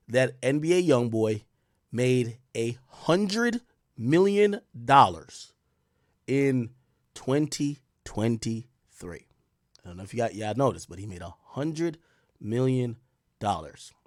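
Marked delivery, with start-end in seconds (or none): 1.9-9.2 slow for effect. Real, not acted. Stretching out the big number. 10.9-13.9 hushed. Real, not acted. Hushing the big number.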